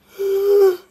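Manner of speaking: loud, high sigh; big loud sigh on waking. Real, not acted. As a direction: heave a loud morning sigh. Waking up in the morning.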